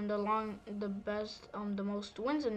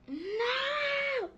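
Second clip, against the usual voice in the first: shout. Real, not acted. Losing the fight, yells.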